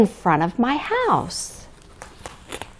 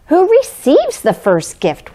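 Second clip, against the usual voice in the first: eager question; 7 semitones higher than usual. A narrator's reading. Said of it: "we all asked".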